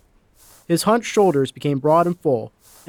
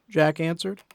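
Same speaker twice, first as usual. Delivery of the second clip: ordinary delivery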